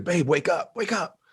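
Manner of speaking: mildly urgent, high reenacted nudge awake; urgent waking call. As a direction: act out rousing a sleeping partner, pitch climbing. Retold waking of partner at night.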